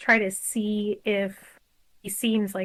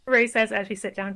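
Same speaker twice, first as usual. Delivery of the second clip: normal talking voice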